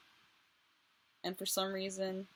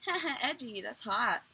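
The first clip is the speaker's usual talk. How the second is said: mock flirty; 5 semitones higher, 3 dB louder than usual. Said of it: "and so I was like".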